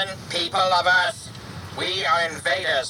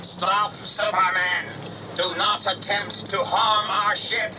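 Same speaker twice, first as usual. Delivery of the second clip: higher, commanding metallic voice; metallic alien voice; 6 semitones higher than usual. A narrator's reading. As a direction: order him to stop, alien. Alien voice again.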